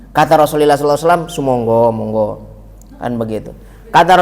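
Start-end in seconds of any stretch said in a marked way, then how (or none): 1.3-2.3 low, soft and unhurried; gracious assent. Retold or imitated. The Prophet granting a companion's request to pray along, gracious and low.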